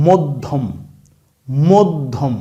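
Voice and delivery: মধ্যম with a doubled "dd", "moddhom"; pronunciation demo. Shown as a demonstration. Teacher to class, example word: মধ্যম with doubled "dd". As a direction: stress the doubled middle consonant in মধ্যম. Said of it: "এখানে যেই শব্দটা".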